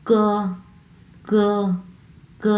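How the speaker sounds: ক drilled as in the Chinese dialect; Chinese k sound. Shown as a demonstration. Teacher showing learners the k-type reading, ক drilled. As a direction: model the ক the teacher recommends. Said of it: "like this".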